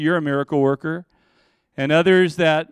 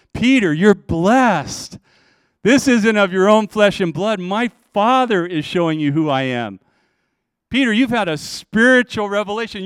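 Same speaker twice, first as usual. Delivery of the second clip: raised, louder, emphatic address to Peter; fervent blessing; 5 semitones higher, 3 dB louder than usual. Acted. Preacher voices Jesus to Peter.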